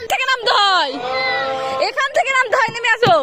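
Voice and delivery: very high, loud sing-song coaxing. Real, not acted. Guiding child on slide. (উঁচু গলায় শিশুকে ভোলানো)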